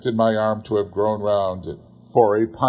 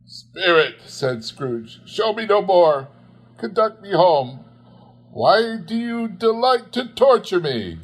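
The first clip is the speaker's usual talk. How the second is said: higher, louder, desperate begging; a tormented old man, higher and louder; anguished pleading; 10 semitones higher, 3 dB louder than usual. Acted. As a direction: plead with the ghost to stop. Scrooge begs the ghost.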